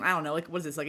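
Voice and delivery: normal talking voice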